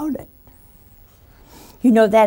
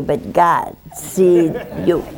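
ordinary talk, nothing marked

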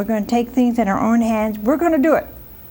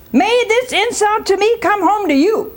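indignant wife; 10 semitones higher than usual. Acted. Sarah curses Abraham.

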